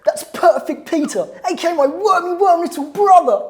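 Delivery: high, loud cheeky cartoon boy; smug naughty-kid sneer; Horrid Henry. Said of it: "can you do the voice".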